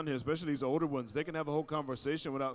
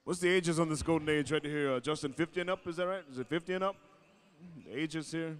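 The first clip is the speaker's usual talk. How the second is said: arena announcer; 2 semitones higher, 3 dB louder than usual. Acted. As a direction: summon the Golden Age dancers to the floor. MC calling judges.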